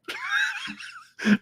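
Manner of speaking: a sudden shrill laugh; shrieking laugh. Real, not acted. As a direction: let out a squealing cackle. Laughing at chat.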